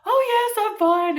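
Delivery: very high, loud, hammed-up cheeriness; over-bright fake fine. Crying caller assures agent she's okay, absurdly high and cheery. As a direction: chirp it far too brightly to cover crying. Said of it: "each time I'm like".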